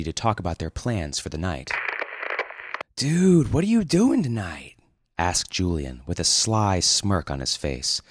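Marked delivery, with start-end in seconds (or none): 3.0-4.7 high, loud surfer-dude voice; eager high dude voice; Julian's voice. Acted. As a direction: play the excited dude colleague. Julian voiced in read story.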